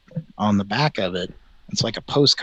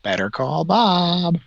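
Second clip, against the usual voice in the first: higher, slow jingle-like slogan; 7 semitones higher, slower than usual. Pretend. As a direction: sing it out like a TV ad. Mimicking the ad slogan.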